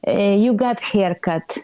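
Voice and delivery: put-on mild Eastern European accent, sceptical; lightly voiced critical mother; Eastern European mom. Mother greeting daughter's new haircut, mild Eastern European accent. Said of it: "That's the accent".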